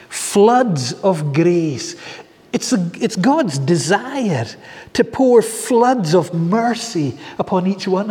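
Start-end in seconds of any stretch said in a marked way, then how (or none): 0.1-2.0 savoured slowly. Real, not acted. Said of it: "I like that".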